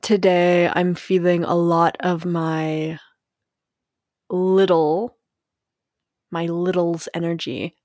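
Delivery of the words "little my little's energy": ordinary delivery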